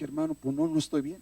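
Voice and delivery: high, soft voice, as if upset; small high-pitched retold complaint; distressed reply. Retells his distressed phone answer.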